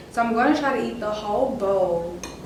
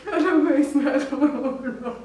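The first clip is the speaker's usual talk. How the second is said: laughing; 3 semitones higher, 3 dB louder than usual. Real, not acted. Laughing through spicy food pain.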